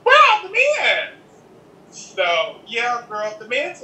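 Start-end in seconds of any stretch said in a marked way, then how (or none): 0.0-1.1 high, disbelieving squeal; stunned, searching for the men; mock shock, pitched way up; incredulous. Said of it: "and I'm like".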